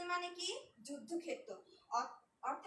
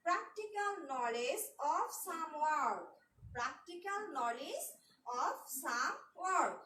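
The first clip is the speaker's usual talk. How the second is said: slow dictation; much slower than usual. Shown as a demonstration. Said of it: "dictating a definition slowly".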